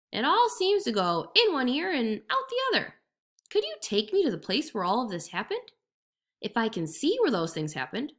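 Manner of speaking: sly jackal playing dumb; jackal's voice. A narrator's reading. Jackal speaks, held voice.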